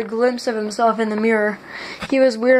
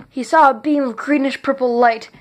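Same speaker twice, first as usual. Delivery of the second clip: plain reading voice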